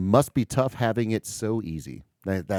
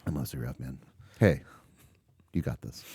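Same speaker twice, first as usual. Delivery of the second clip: low, hushed consoling voice; quiet acted condolence and encouragement; sincere sympathy; 9 semitones lower, 6 dB quieter than usual. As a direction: comfort a friend very softly. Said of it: "you can say that legitimately".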